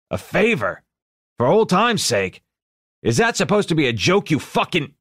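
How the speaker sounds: raging gangster yell; furious shout. A narrator's reading. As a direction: bellow it like a furious mob boss. Furious gangster mocks caller.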